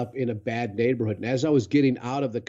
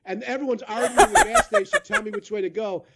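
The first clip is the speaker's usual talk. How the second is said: ordinary delivery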